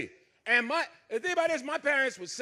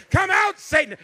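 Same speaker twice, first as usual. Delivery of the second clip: louder and higher final exorcism cry; preacher's climax; 8 semitones higher, 9 dB louder than usual. Retold or imitated. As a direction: crank it up to the peak. Mother casting out Satan.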